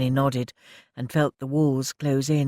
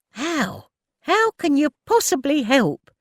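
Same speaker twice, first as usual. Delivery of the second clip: much higher and loud, snapping the question; angry demand; 13 semitones higher, 5 dB louder than usual. Acted. Elsie rounding on Ted, angry and high. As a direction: challenge him hotly. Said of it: "Elsie demanded angrily".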